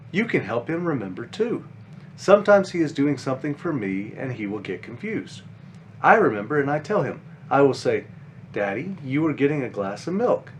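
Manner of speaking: put-on gentle, lively storybook read for kids; reading to children. A narrator's reading. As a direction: read it like a bedtime picture book. Reads children's book aloud.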